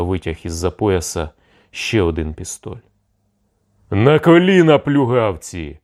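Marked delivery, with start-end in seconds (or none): 3.9-5.8 thundered command. A narrator's reading. Cossack drawing his sabre on the envoys, thundering with anger. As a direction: roar them down to their knees. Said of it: "гримнув Гаврусь Мережка".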